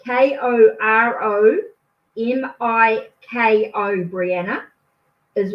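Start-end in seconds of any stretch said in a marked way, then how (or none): none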